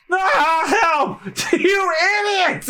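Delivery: high, shouted cry for help; high mock-panicked scream; panicked yell. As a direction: yell as you get carried away. Luffy carried off by bird.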